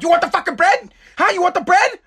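high, mocking furious wife; angry wife mocking him over the bread. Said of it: "the wife's like".

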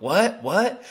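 jolted awake confused; mock groggy surprise. Woken at 2am by trainer, startled.

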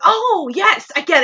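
high, eager oh yes; a lit-up moment of recognition; mock eureka. Host recognizing Mayhem from the ads, high eager recognition. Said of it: "and then I was like".

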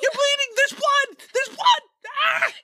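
high, loud mock shriek of alarm; hammed-up panic. Imitating the actress panicking over Whit's leg. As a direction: overplay the panic for laughs. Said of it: "she had a really fun time playing this scene".

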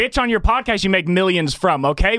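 high-pitched, indignant tirade; worked-up, high-pitched outburst; angry role-play rant. Acted. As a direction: fire back as the fed-up lot attendant. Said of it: "Let's do a role play".

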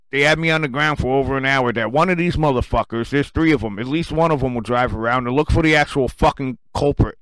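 ordinary talk, nothing marked

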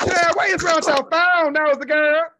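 high, loud teasing call. Real, not acted. Teasing Chad about the phone, loud call-out. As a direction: call out to the nosy friend. Calling out to someone nearby.

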